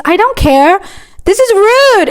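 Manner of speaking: high-pitched mock outrage; imitated indignation. Offended customer scolding the cashier, indignant.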